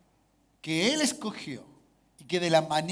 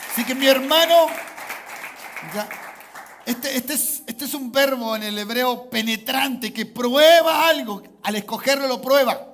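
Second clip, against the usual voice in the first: loud, raised-pitch exhortation; full-force preaching; 4 semitones higher, 7 dB louder than usual. Real, not acted. Preacher urging church to applaud being chosen, loud and exultant. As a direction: rouse the congregation with a ringing shout.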